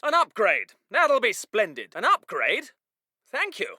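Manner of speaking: low, understated officer; mildly pleased. Acted. Officer receiving an upgrade, politely pleased. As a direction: accept it with quiet courtesy.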